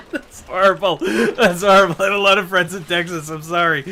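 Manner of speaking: loud, high, real laughter through the words; laughing. Real, not acted. Apologising to Texan friends, helpless loud laughing. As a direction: apologise while cracking up. Laughing at own Texas joke.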